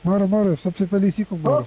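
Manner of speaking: shouting to attack; loud yell. Real, not acted. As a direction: yell it at the team. Team egging on in a fight. (ज़ोर से चिल्लाकर)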